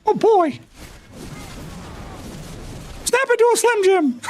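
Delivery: squeaky mouse wrestler catchphrase; Mickey Mouse Savage. Pretend. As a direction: falsetto Slim Jim ad shout. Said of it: "Mickey Mouse Savage".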